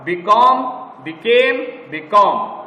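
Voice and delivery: बिकॉम and बिकेम rising, last बिकॉम falling; pronunciation demo. Shown as a demonstration. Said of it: "एक बारी और". (साफ़ उच्चारण के साथ बिकॉम, बिकेम, बिकॉम)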